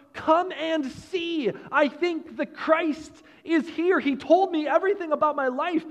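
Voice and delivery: a touch of joyful calling out; eager announcement. Retold or imitated. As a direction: Samaritan woman inviting the town, lightly excited. Woman calling out to town.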